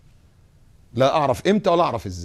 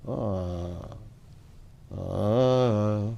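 mock resentful sigh; 5 semitones lower, 6 dB quieter, much slower than usual.

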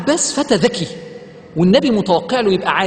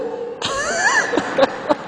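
high tearful laughter; helpless laugh; 5 dB quieter than usual. Real, not acted. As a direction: laugh till it almost cries. Laughing at the story. (ضحك حتى البكاء)

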